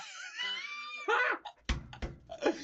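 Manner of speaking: sudden high shriek of laughter; a shrieking laugh at the guy's outfit. Real, not acted. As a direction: burst out in a piercing squeal of laughter. Cracks up at the outfit.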